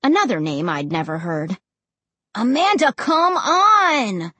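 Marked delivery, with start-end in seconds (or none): 2.3-4.3 drawn-out, higher-pitched whine; stretched, complaining kid's plea; whining. A narrator's reading. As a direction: whine impatiently at your sister. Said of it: "Josh whined".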